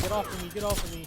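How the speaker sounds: mock panic, urgently demanding release; imitated freak-out. Bitten kid to the boy biting his leg, loud freaked-out plea. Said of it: "like, freaking out".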